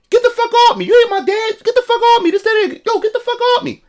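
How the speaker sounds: put-on high, strained yell of a defiant kid; mock kid screaming get off me; angry kid yelling. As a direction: shout it back like a cornered kid. Kid cursing at dad retold.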